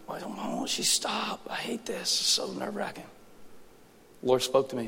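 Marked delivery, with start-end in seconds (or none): 0.1-3.1 high, strained whispered complaint; tense parent venting under the breath; frustrated whisper. Retold or imitated. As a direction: whisper through mounting exasperation. Frustrated parent of crying baby.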